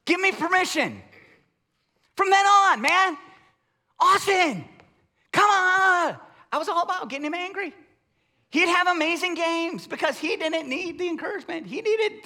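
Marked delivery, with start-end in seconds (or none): 2.1-6.2 loud, high coaching shout; coach yelling. Retold or imitated. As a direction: fire up the player from the sideline. Coach yelling to get him angry.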